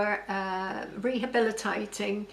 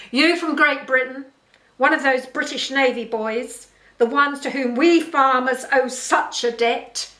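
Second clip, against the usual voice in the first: loud, raised-voice taunting questions; derisive challenge; 3 semitones higher, 7 dB louder than usual. A narrator's reading. Farm boss taunting the British navy boy, derisive challenge. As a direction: sneer at him as a bullying boss.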